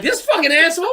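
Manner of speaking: high-pitched mock outrage; hammed-up incredulous complaint; indignant disbelief. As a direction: squawk it in comic indignation. Mocks himself being singled out.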